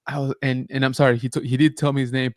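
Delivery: ordinary talk, nothing marked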